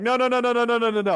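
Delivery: high, loud, rapid string of no's; hammed-up hasty denial; retold panic. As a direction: frantically wave them off, mock-alarmed. Retells panicked reaction to chat.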